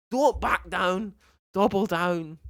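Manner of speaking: slow, badly faked Scottish accent; terrible Scottish accent. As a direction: put on a bad Scottish accent, drawing it out. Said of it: "That was a terrible Scottish accent".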